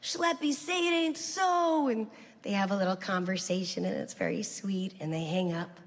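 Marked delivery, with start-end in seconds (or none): 0.0-1.9 higher, slow, mock-mournful; drawn-out pleading to an old pal; old friend on speaker. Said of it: "Steve Foreman goes".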